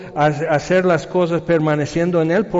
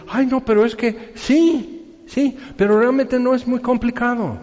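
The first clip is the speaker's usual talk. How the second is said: whiny excuse; 8 semitones higher than usual. Retold or imitated. Preacher imitating a churchgoer's excuse, higher whiny voice. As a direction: mimic a lukewarm believer brushing it off.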